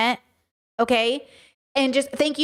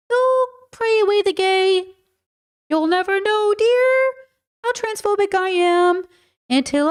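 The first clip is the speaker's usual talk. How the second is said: high, slow, sing-song mock mother; mock creepy mother; 8 semitones higher than usual. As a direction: croon like a smothering mother, hammed-up. Mock mother voice singing to Ethan.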